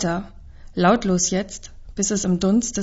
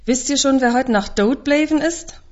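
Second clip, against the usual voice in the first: put-on breathless boy blurting out the news, higher and louder; blurted news; 7 semitones higher, 3 dB louder than usual. Boy who ran all the way blurts out the news, breathless and high. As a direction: burst out with it, stumbling. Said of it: "stößt er schließlich hervor".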